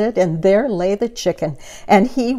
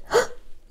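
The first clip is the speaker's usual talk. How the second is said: soft gasp; 5 dB quieter than usual. Acted. Opening the icebox.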